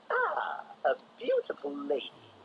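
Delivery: high, leering villain voice; Joker voice. A narrator's reading. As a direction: drool over it like a madman. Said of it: "said the Joker".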